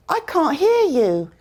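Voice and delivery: high "can't hear" with no T sound; British "can't" ending on n before "hear". Shown as a demonstration. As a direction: leave the T out of "can't". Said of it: "I didn't pronounce the T".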